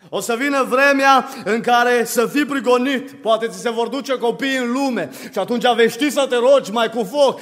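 urgent, forceful sermon warning; impassioned preaching. Real, not acted. Preacher warns the congregation of coming persecution, impassioned preaching. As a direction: pile up the warnings without letting go.

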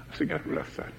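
breathy, hushed repeat; whispered. Real, not acted. Preacher repeating that Jesus cried out, in a whisper. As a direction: drop to a whisper for effect. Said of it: "repeated phrase, whispered".

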